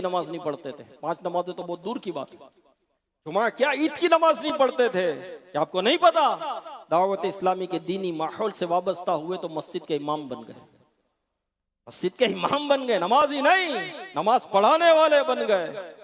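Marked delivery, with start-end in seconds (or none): 3.3-6.2 high, loud rhetorical question; raised-voice preacher's astonishment; emphatic incredulous repeat. Real, not acted. Repeats astonishing fact to congregation. 11.9-15.9 high, emphatic preaching that grows louder; rousing proclamation. Real, not acted. Proclaims transformation to congregation.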